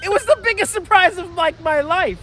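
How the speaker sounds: shouting with excitement; excited shout. Real, not acted. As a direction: blurt it out, thrilled and loud. Huge surprise at book's ending.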